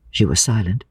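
ordinary delivery

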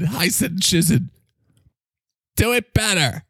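ordinary delivery

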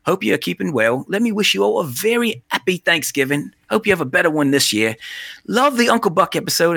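put-on Scouse email reading; Liverpool accent. Podcaster reading UK pal's email, rough Liverpool accent. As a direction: imitate a Liverpudlian, not quite convincingly. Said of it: "I'll try it more in a Liverpoolian accent".

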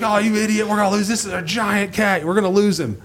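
frantic imitation of a scolding partner; mock panic. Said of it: "he's freaking out".

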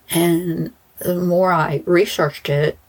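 normal talking voice

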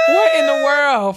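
loud high laughing cry; laughing disbelief. Real, not acted. Cracking up at her own letter, loud laughing disbelief.

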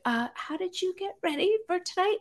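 high, timid little question; small, high, nervous voice. Retold or imitated. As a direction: retell a nervously tentative question, softly. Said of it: "I was so nervous".

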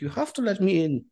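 stubborn hammed-up German accent; [name]'s German accent. Insisting to the security guard, mock German accent. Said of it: "not as inappropriate as his German accent".

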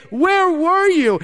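high, loud, slow accusing cry; hurt reproach. Retold or imitated. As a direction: demand it through grief. Sisters confront Jesus, grieving.

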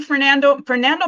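ordinary talk

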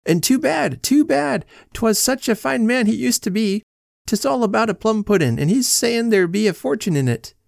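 high-pitched Irish policeman, lamenting; officer's voice. Acted.

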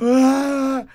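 high mock sob of distress; a short teary wail, acted out; crying wail. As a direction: imitate her breaking down crying. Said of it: "nos habla llorando".

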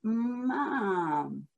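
Mom said softly, carefully blended; softly modelling Mom for the child; blending Mom. Shown as a demonstration. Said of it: "let's start at the beginning".

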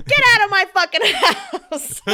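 high, loud yell, laughing through it; fierce yell. Retold or imitated. Friend kicking the boyfriend out, yelling with a laugh. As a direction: throw him out of the house. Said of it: "because she's a fucking badass, was like".

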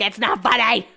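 exaggerated annoyed retort; mock annoyance. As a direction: act out being teased and annoyed. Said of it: "'cause you're annoyed".

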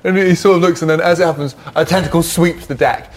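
ordinary delivery

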